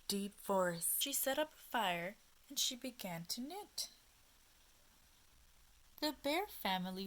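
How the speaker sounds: gentle, expressive storytime voice; reading to children. A narrator's reading. Teacher reading a picture book to little listeners, gently expressive. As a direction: read it like a picture book at bedtime.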